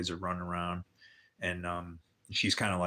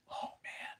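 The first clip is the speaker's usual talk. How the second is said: whisper; 13 dB quieter than usual. Real, not acted. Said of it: "whispered exclamation".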